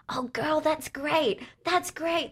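put-on high, bubbly girlfriend praise; gushing praise. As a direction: gush over a friend's work, way up high. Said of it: "Everyone is like".

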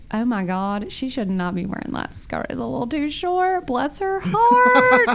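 Southern belle tutting sweetly over a short skirt; catty bless your heart. Retold or imitated. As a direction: judge the skirt in a sugary put-on voice. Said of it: "it's all in the tone".

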